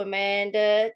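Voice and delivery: normal talking voice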